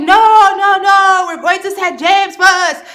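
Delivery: loud, high protest, slowing at the end; insistent shout. Retold or imitated. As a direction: shout them down from the back seat. Friends insist on their route.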